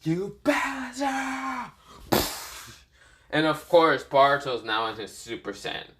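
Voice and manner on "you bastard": high, drawn-out cry without full volume; Boruto's angry cry. Acted. Boruto cursing his enemy, high stretched angry cry. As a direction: stretch out a high, held-back mock yell. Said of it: "Boruto says".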